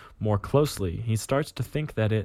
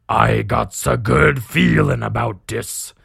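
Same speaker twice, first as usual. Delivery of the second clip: slow, louder orc voice; 5 dB louder than usual. Acted. Orc captain plans his next raid, slow orc voice. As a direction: savour it as a scheming orc boss. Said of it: "you read an orc talking".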